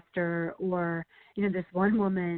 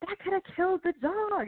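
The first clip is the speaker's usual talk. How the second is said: alarmed editor, mock; 11 semitones higher than usual. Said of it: "she was so like".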